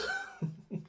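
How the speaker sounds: squeaky laugh carried over from the impression; high giggle. Real, not acted. Laughing after mimicking the coach, high giggle.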